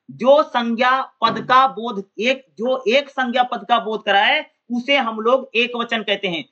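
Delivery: slow, spaced dictation pace for notes; dictating a definition word by word. Shown as a demonstration. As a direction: read it out slowly so students can write. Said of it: "ध्यान सुनना".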